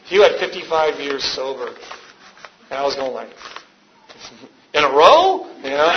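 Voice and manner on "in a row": higher, louder incredulous question; mock astonishment. Hearing of fifty-five years sober, astonished. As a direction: ask it in comic amazement.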